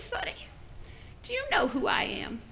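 put-on whispery old-woman voice; very soft, high elderly challenge; little old lady. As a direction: whisper it as an indignant granny. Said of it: "little old lady".

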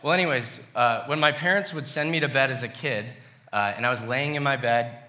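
ordinary talk, nothing marked

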